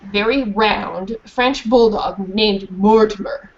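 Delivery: ordinary delivery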